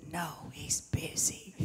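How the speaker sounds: put-on slow, hushed child whisper. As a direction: whisper it slowly, like a secret. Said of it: "He whispered".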